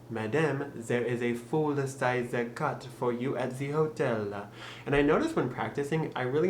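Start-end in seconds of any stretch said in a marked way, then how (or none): 0.1-4.5 slow practice line in a French accent; over-pronounce French-accented English carefully; deliberate thick French accent. Shown as a demonstration. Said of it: "you can practice it and say".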